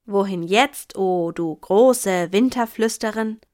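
mock the grand title with mild annoyance; a touch of testy sarcasm, drawn out; irritated. A narrator's reading. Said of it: "fragte Lino gereizt".

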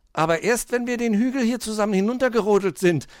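put-on high, quick, playful child voice; merry. A narrator's reading. Hoppel insists on sledging down first, merry and high. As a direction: rush it out cheerfully. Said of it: "lachte Hoppel".

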